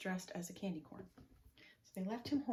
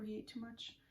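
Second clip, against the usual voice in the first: plain reading voice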